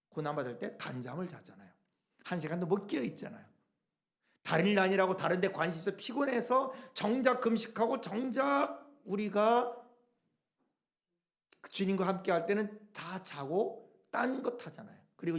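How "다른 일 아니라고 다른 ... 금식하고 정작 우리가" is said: normal talking voice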